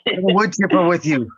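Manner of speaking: furious dad. Retold or imitated. Dad finding his garden ruined, mock outrage. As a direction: explode at the kid over the garden. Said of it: "and be like".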